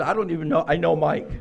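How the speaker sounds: ordinary delivery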